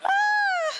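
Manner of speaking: a high, loud laughing shriek; real shriek of laughter; delighted shriek. Real, not acted. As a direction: burst out in a squealing laugh. Reacting to the kid's note.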